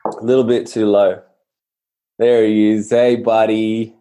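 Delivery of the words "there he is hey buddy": dog greeting. Real, not acted.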